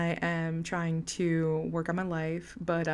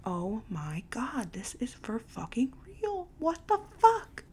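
retold awe, whispered and climbing to a squeak; slow, breathless disbelief rising very high; stunned whisper; 5 semitones higher, 4 dB quieter, slower than usual. Seeing the police station's number pop up, stunned whisper.

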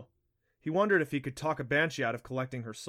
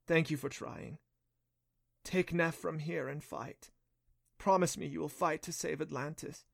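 weak naiad; 3 semitones higher than usual. A narrator's reading. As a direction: plead gently, with little strength left. Weak naiad pleads softly.

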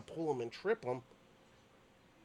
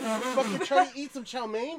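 incredulous friend; 10 semitones higher, 9 dB louder than usual. Retold or imitated. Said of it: "he goes".

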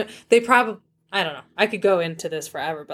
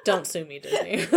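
ordinary talk, nothing marked

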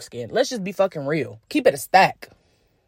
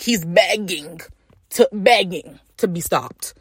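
ordinary delivery

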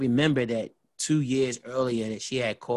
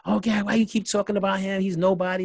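higher, quicker imitation of her brushing it off; lightly voiced girlfriend, pitched up; dismissive girlfriend; 7 semitones higher, faster than usual. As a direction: mimic an impatient girlfriend.

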